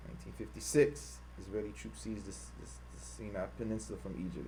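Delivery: reading the timeline half to himself, very soft; muttered reading. Real, not acted. Streamer reading the site to himself, soft mutter. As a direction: mumble the text off the screen.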